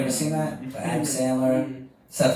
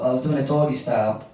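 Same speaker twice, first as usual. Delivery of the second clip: ordinary talk, nothing marked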